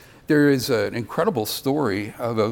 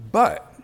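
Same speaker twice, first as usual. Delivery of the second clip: "but" punched high and louder; emphatic BUT; 8 semitones higher, 5 dB louder than usual. Shown as a demonstration. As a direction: set "but" apart as the important turn. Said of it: "It's an important bu-, but".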